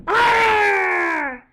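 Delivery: loud high wolf scream. Acted. Wolf screaming at the robot pig, loud. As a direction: scream in terror. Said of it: "screamed the wolf".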